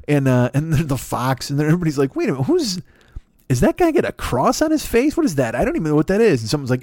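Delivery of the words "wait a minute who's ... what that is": mock-baffled, much higher; a puzzled fan squinting at the album cover; confused fans. Confused fans see new Kiss member.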